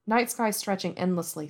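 usual speaking voice

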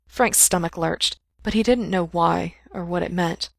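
ordinary delivery, nothing marked

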